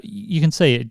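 ordinary talk, nothing marked

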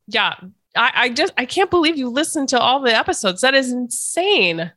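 ordinary talk, nothing marked

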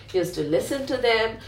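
ordinary delivery, nothing marked

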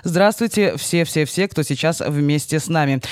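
ordinary talk

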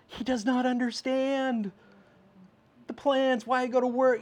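put-on high childlike complaint about dad's work; mimicking a young boy missing his dad; imitating little Nathaniel. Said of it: "I'm like".

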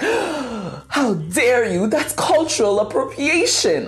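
slow, high, hammed-up scandalized sister; dramatic outrage. Sister reacting to the podcast name, mock outrage. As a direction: gasp and clutch your pearls in mock horror. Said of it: "I'm just being dramatic".

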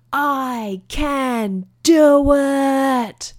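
high, hugely drawn-out cry; triumphant battle cry. Retold or imitated. Boy stops atop the hill before riding, triumphant battle cry. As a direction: stretch every word into a long, high call. Child's climactic drawn-out declaration.

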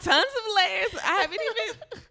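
high, loud, talking through laughter; laughing. Real, not acted. Cracking up with a friend over her complicated date, laughing. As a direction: crack up while getting the words out.

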